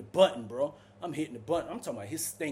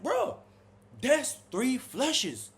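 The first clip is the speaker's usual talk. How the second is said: high, slow, fed-up complaint; exasperated; 10 semitones higher, 3 dB louder, much slower than usual. Retold or imitated. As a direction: drag it out in disbelief. Complaining to cellmate about flushes.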